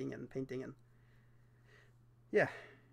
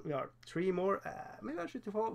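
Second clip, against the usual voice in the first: usual speaking voice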